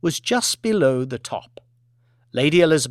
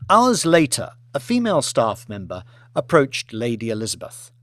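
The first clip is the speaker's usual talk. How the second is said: plain reading voice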